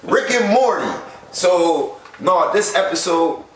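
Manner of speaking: high, loud comic shout. Real, not acted. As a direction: yell it in protest.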